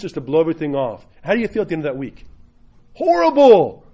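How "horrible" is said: loud, high, drawn-out outburst; emphatic dismay. Acted. Preacher answering how that week felt, loud dismay. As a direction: belt it out in mock misery.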